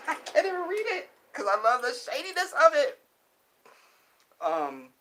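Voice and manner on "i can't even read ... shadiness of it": laughing. Real, not acted. Laughing while reading.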